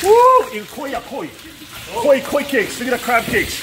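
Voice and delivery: high, loud, giddy chant of koi; excited cheering. Real, not acted. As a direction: hype up the koi cakes. Cheering at cooking fillets.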